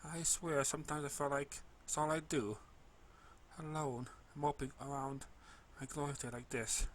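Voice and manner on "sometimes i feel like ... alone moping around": ordinary delivery, nothing marked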